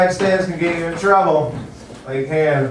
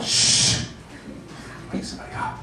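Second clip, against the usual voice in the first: shushing; 9 dB quieter than usual. Retold or imitated. Hushing her during sex.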